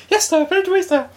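high, simpering yes-man voice; servile. Retold or imitated. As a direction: grovel like a servant. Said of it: "He was like".

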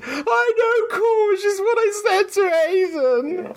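put-on high teary little boy's wail; tearful child. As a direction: wail it like a crying child caught out. Child confesses in tears.

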